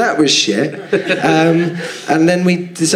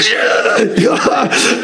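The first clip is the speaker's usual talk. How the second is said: angry retort; 10 semitones higher than usual. Retold or imitated. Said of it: "he came back again and said".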